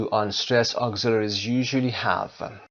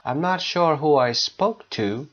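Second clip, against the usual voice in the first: slow, higher-pitched sentence ending on "to" as too; stressed final "to"; 4 semitones higher, much slower than usual. Shown as a demonstration. Teacher showing sentence-final word, stressed "to". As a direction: let "to" ring out fully. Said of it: "if it is the last word in a sentence".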